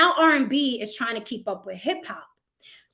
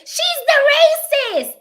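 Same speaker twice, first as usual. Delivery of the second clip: much higher, louder, slow shrieking accusation; mock accuser; 8 dB louder, much slower than usual. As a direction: screech it like an outraged critic. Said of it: "go everywhere you see me and say".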